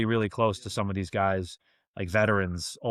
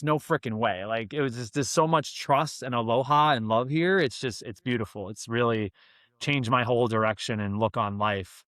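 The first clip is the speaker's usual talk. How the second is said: ordinary delivery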